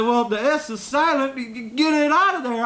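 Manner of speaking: high-pitched, put-on Southern voice; a high, twangy retort; Southern buddy impression. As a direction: mimic the buddy defending his point. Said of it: "And he said".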